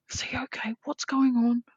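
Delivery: high, hushed whisper, rising in alarm; freaked-out whisper. Retold or imitated. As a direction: whisper it like you're freaking out, then let it climb. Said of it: "I freaked out".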